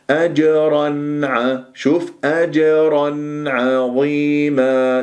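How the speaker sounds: أجرا عظيما slow, نون sounded before عين; clear nun. Shown as a demonstration. Teacher modelling clear نون before عظيما. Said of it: "لا تأكل النون".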